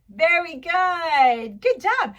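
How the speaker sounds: slow, loud, high teacher cheer; delighted praise. Pretend. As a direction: stretch out the praise for a little pupil. Praising a pupil after clapping.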